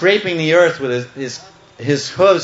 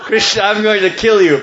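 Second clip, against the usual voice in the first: raised, loud comic death threat; mock threat; 7 semitones higher, 5 dB louder than usual. Bull demon threatening Krishna, mock threat. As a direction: ham up the menace, pitched high. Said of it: "He was going".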